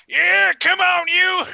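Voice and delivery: high, drawn-out goading shout; battle yell. Acted. Wrestler leaps on the gator, yelling. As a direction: yell it slow and loud mid-fight.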